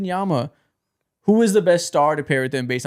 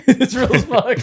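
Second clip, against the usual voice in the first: laughing; 8 semitones higher, 5 dB louder, slower than usual. Real, not acted. Laughing at the clip.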